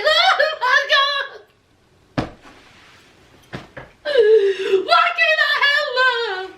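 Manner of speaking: hammed-up ugly cry; imitating a lovesick woman bawling between gasps; Jenny. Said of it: "Jenny did the ugly cry".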